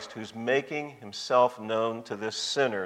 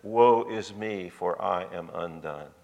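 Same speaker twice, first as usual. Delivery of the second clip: slow, sinking low and soft; understated cry of being undone; woeful lament; 6 semitones lower, 3 dB quieter, slower than usual. Retold or imitated. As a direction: lament it slowly, voice falling away to quiet despair. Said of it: "Isaiah said".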